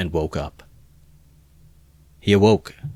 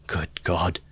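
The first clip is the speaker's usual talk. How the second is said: whisper; 4 dB quieter than usual. A narrator's reading. Voice sinks to a whisper.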